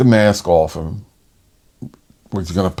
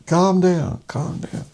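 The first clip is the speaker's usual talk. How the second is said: soothing; 10 semitones higher, slower than usual. Retold or imitated. Soothing the upset father.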